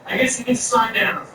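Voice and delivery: normal talking voice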